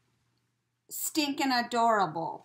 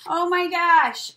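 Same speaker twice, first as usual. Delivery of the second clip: amazed; 7 semitones higher, 11 dB louder, slower than usual. Real, not acted. Reacting to a funny gift.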